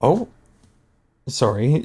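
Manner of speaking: ordinary delivery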